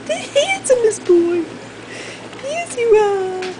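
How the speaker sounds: high cooing praise, slow; high pet praise. Real, not acted. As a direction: adore the dog. Praising dog.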